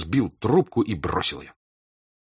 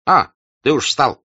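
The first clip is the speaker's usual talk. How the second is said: gruff hussar; 7 semitones higher, 8 dB louder than usual. A narrator's reading. Denisov enters grumpy.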